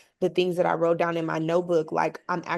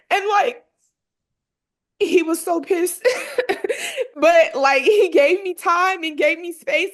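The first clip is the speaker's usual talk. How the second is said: high, teary laugh-cry, louder; half sobbing, half laughing with relief; laughing through tears; 10 semitones higher, 5 dB louder than usual. Real, not acted. Telling how her dad finally gave her space, laughing through tears. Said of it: "it's making me emotional".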